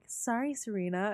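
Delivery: quiet mock apology; smug. June needling Serena from the bed, smug. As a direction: murmur a smug, fake sorry. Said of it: "June just looks smug as all".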